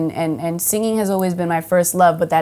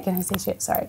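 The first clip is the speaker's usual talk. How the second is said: usual speaking voice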